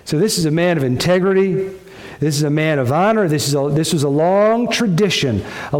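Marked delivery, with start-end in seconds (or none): none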